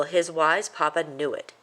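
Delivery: ordinary delivery, nothing marked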